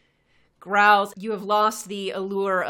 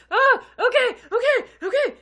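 scared miner; 10 dB louder than usual. Acted. GM voices the fleeing miner.

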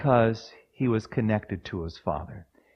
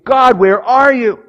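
much higher, louder, slow plea; anguished cry; 13 semitones higher, 14 dB louder, slower than usual. Retold or imitated. Preacher reliving a trial, crying out to God loudly. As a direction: cry out to heaven.

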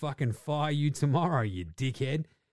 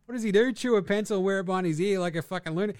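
mock rant; 9 semitones higher, 3 dB louder, faster than usual. Ranting at Patricia.

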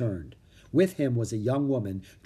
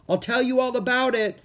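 high, loud call across a distance; 12 semitones higher, 5 dB louder than usual. A narrator's reading. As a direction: call back to his sister. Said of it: "Jack called".

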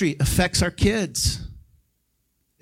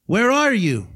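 God calling; 4 semitones higher, slower than usual. Retold or imitated. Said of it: "God went to Adam and he said".

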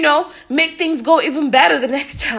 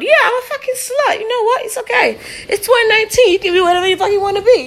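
much higher, laughing through it; 9 semitones higher, faster than usual. Real, not acted. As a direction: giggle while owning it. Laughing self-mocking confession.

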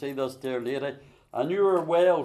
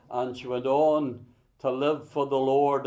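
ordinary delivery

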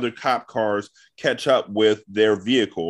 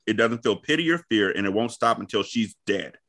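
ordinary talk, nothing marked

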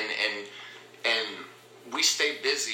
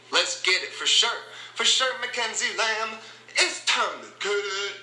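loud, high rallying cry; high chanted hype catchphrase; hyped-up; 10 semitones higher, 3 dB louder than usual. Real, not acted. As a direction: pump them up, stretching the last words. Hyping up with catchphrase.

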